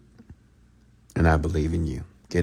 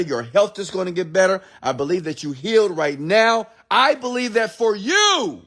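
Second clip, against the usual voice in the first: high, rising sermon voice; full-force motivational preaching; 10 semitones higher than usual. Acted. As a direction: lift them up with conviction. Preaches belief over listeners.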